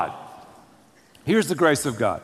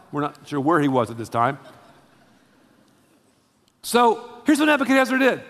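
normal talking voice

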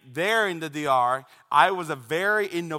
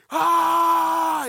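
long, high, loud scream; ten-year-old's furious shriek; angry scream; 12 semitones higher, 5 dB louder than usual. Retold or imitated. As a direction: let out an enraged yell. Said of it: "And I just screamed".

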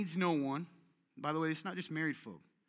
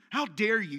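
mock indignation; 4 semitones higher, 6 dB louder than usual. Offended person accused.

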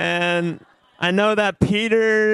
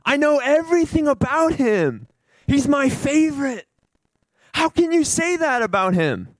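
high, emphatic fatherly protest; impassioned pleading as the Lord; indignant loving defense; 5 semitones higher than usual. Acted. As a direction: defend your children with passionate insistence.

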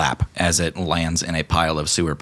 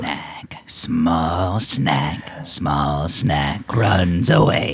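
taunting whisper; much slower than usual. Acted. Grey Gut taunts Snack.

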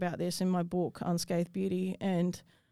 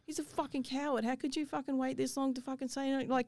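higher-pitched angry outburst at the man; retold anger; 6 semitones higher than usual. Angry at the man who finally backed down, voice raised in pitch. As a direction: vent at him in a raised pitch. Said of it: "I was angry at him".

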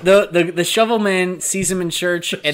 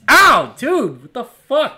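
higher, louder yelp of pain; a mock hurt shout after a belt to the head; 7 semitones higher, 5 dB louder, much slower than usual. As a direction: cry out like it really hurt. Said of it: "they'd be like".

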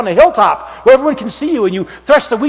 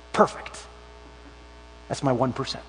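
whispered, much softer "Perfect"; God's soft reply; 8 semitones lower, 15 dB quieter than usual. Retold or imitated. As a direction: hush it like a secret. God answers Gideon softly.

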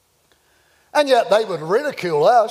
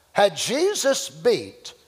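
ordinary talk

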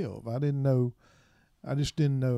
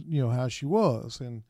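normal talking voice

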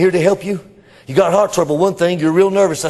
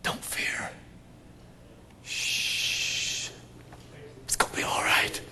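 put-on soft hushing whisper, like to a child; calm a scared child; soothing whisper; 15 dB quieter, slower than usual. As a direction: whisper comfort. Soothing the fearful.

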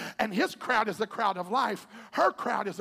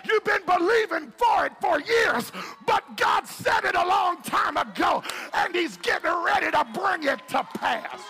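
loud, high, shouted preaching over music; whooping sermon climax over the organ; full-force preaching; 10 semitones higher, 5 dB louder than usual. Acted. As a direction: preach it at the top of your lungs.

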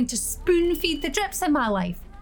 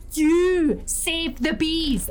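slow mocking plea; 4 semitones higher, much slower than usual. Petition voice, slowed.

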